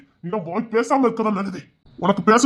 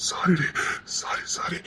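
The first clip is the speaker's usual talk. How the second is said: whispered sorry; 3 semitones lower, 10 dB quieter, much slower than usual. Acted. Husband begs forgiveness.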